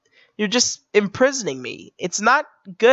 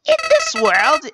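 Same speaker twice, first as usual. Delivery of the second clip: a hint of a manic villain, high on the first word; lightly voiced Joker-style menace; 7 semitones higher than usual. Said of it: "I'm going for a Joker voice".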